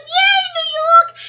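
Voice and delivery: very high, loud, drawn-out cheer; victory cheer. Retold or imitated. Replaying her cheer as New York rides victorious, high drawn-out cheer. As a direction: whoop it slowly way up high.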